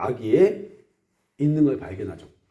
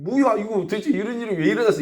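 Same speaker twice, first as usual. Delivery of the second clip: higher, fast resentful grumble; mock grumbling complaint; 7 semitones higher than usual. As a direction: blame each other, quick and higher. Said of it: "원망하면서 하는 말".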